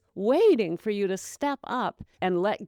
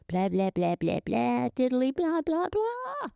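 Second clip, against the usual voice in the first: mock babble climbing higher into a squeak; mocking blah blah; 6 semitones higher than usual. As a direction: parody the nagging voice, rising to a squeal. Mocking the doubt voice.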